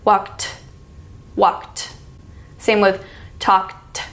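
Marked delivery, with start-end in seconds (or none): 0.0-1.9 "Walked" ending in an aspirated "t"; "Walked" with a puff of breath on the final "t"; ED as breathy t. Shown as a demonstration. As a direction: pull the tongue down and breathe out on "Walked". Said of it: "it makes a s sound".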